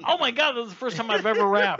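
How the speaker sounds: loud laughing exclamation. Real, not acted. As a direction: blurt it out through laughter. Host laughing loudly.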